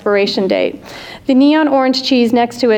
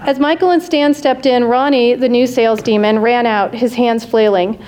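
ordinary delivery, nothing marked

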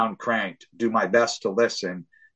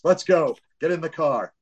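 impatient parent calling; hurried call to the kids; 6 semitones higher than usual. Retold or imitated. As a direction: hurry the kids along, calling out. Rushing kids to the car.

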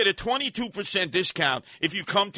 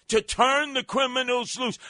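ordinary talk, nothing marked

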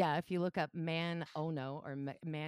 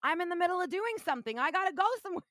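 indignant protest; 8 semitones higher than usual. Retold or imitated. Arguing with the cop who stopped her, rising indignation. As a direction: push back at the cop, getting worked up. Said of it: "I said".

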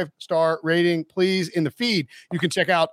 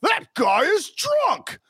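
excited shout; 14 semitones higher, much slower than usual. Real, not acted. Shouting reaction to game.

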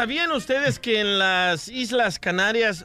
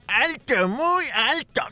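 a hint of a creaky old grandpa; lightly voiced old man; 4 semitones higher, slower than usual. Grandpa answering the interviewer, lightly old-voiced. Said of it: "dice el abuelito de Piolín".

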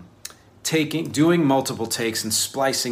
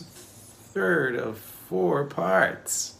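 very slow, stretched and higher; mock-grand announcement; 8 semitones higher, much slower than usual. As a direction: draw out each word for show. Mock-grand repeat of series title.

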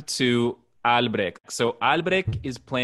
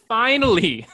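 finally, higher and louder with a laugh; exasperated finally; 10 semitones higher, 8 dB louder than usual. Shown as a demonstration. Cohost laughing that Funes finally runs. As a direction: hit finally with laughing relief.

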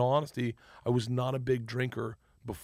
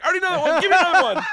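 high, loud, hungry for more; eager excitement; 11 dB louder, much faster than usual. Retold or imitated. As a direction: beg for the next word, thrilled. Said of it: "I got so excited".

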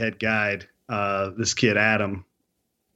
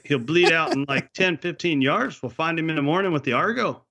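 higher backwoods Canadian, drifting Scottish; Canadian accent turning Scottish; 6 semitones higher than usual. Retold or imitated. Owner reassures hunter about tracking bear, Scottish-tinged Canadian accent. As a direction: let the Canadian accent slide towards Scottish. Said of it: "it also turned Scottish".

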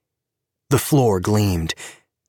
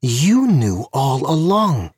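higher, slow, pointed accusation; accusing; 6 semitones higher, 3 dB louder, slower than usual. A narrator's reading. Tanya accuses Gabe across the booth: slow pointed accusation. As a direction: lean on every word of the charge.

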